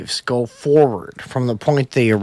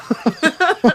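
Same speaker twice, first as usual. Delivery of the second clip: high laugh burst; 13 semitones higher than usual. Real, not acted. Laughs at own joke.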